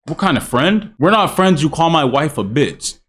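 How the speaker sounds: ordinary delivery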